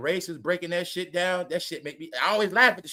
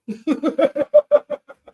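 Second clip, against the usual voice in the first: high, loud burst of laughter; a long helpless cackle; 9 semitones higher, 7 dB louder than usual. Real, not acted. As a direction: crack up at your own question. Amused at his own question.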